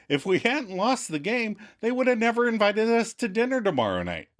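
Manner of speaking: high-pitched defensive argument; Chandler arguing. Retold or imitated. Chandler justifying losing the game to Monica, argumentative. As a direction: imitate protesting, voice climbing high. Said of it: "Chandler argues against himself".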